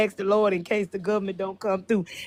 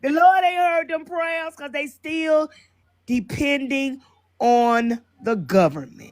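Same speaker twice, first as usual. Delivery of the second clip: preaching; 4 semitones higher than usual. Real, not acted. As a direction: preach it out with force. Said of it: "I just got to say it like that".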